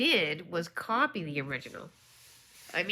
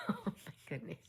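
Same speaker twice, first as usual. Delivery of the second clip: ordinary talk, nothing marked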